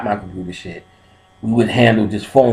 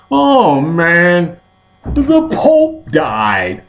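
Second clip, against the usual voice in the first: high, drawn-out mock-mournful announcement; dramatic; 7 semitones higher, 3 dB louder, much slower than usual. Coworker announcing the Pope's death, hammed-up and drawn-out. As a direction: ham up the grief, stretching every word. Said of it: "'cause he did it so dramatic".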